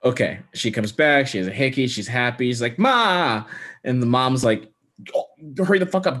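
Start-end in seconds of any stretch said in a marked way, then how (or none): 2.8-3.4 high loud call to mom; excited call across the house. Retold or imitated. Excited daughter calls to mom. 5.2-6.2 high, fast hurry-up from mom; fast mock mom scolding; mock nagging mom. Mom voiced in retold scene.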